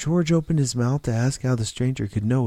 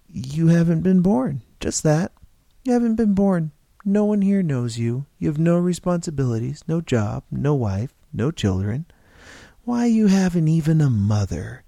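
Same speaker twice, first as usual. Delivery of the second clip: emphatic; 6 semitones higher, 4 dB louder than usual. Acted. Stranger declares George unborn.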